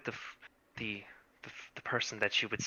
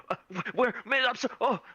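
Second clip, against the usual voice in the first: higher, flustered startled gasps; startled awake; 10 semitones higher, 3 dB louder than usual. Acted. Woken girl jolts out of bed, startled. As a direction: jolt awake in a panic. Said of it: "jolts out of bed".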